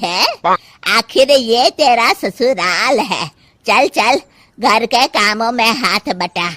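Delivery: loud, slow bossy scolding; scolding mother-in-law. Acted. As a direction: order her about, loud and taunting. Mother-in-law orders the bahu about. (सास की डाँटती हुई आवाज़)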